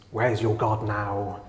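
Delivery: soft, slow echo of the taunt; hushed taunt. Retold or imitated. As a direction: let the jeer hang, quietly.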